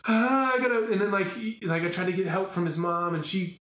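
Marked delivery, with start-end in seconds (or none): 0.1-0.9 high, stammering and frantic; flustered panic. Retold or imitated. Dad late for audition, high flustered stammer. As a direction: sputter like a panicked dad out of time. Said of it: "And you're like".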